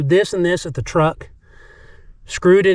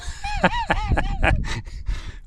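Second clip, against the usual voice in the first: amused laugh; 6 dB quieter than usual. Real, not acted. Laughs at the plumber story.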